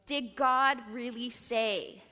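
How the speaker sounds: slow, drawn out, doubting; serpent's question. Acted. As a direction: plant doubt slowly, word by word.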